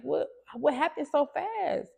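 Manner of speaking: a mother's panic breaking through; sudden high alarm at the bedside; alarmed question. Retold or imitated. Mother at daughter's ICU bed.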